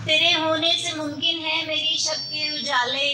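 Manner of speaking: measured Urdu mushaira recital; poetry recitation. Acted. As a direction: recite verse in long, even phrases.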